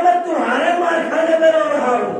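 high-pitched mock-tearful reply; Bahlol. Bahlol tells Harun whom he cries for, acted high-pitched lament. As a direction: act out a weeping man's sustained complaint. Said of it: "जनाब बहलोल रोते रहे".

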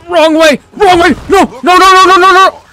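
very high, loud frantic shouts; panicked shouting. Real, not acted. Gamer heading the wrong way, shouting in panic.

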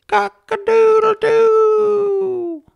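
long, high, slow cock-a-doodle-doo; rooster crowing. A narrator's reading. Rooster greeting the sun to wake the farmer, crowing. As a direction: crow it out like a rooster at sunrise. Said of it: "the rooster crowed".

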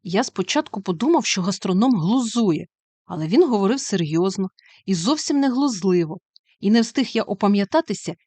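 ordinary delivery, nothing marked

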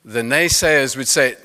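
ordinary talk, nothing marked

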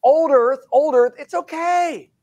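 hammed-up soothing voice, like talking down to a worried crowd; mocking old-earth reassurer. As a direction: parody of a pastor calming people about an old earth. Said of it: "a whole bunch of other people going".

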